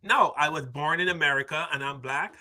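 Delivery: usual speaking voice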